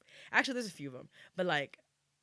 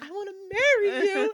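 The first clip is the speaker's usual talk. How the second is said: excited confession; 12 dB louder than usual. Retold or imitated. Acted boy's confession, laughing.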